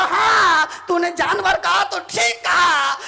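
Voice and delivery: high, loud acted affirmation; emphatic insistence. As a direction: insist as the husband. Husband insists loudly.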